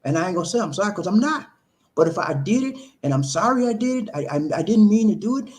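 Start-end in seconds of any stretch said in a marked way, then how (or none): none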